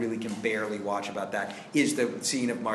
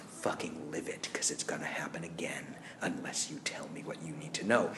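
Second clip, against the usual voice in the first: low, softer menacing whisper; terrifying whisper; 8 semitones lower, 8 dB quieter than usual. Retold or imitated. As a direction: threaten in a low hushed voice. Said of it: "He was terrifying in the role".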